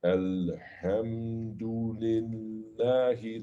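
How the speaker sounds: slow, drawn-out recitation; slow measured Quran recitation; slow tartil recitation. Shown as a demonstration. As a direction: stretch each sound with tajweed. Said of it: "like this, okay?".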